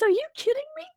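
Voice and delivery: high, incredulous son voice; son's disbelief. Retold or imitated. Bonus son brushing off his stepmother's fear, high incredulous. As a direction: pitch it way up in disbelief. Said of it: "he says".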